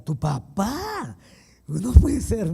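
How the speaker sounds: high, loud astonished cry; woman stunned that his father converted, loudly amazed; shocked disbelief. Retold or imitated. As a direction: gasp it out in disbelief. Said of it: "me decía".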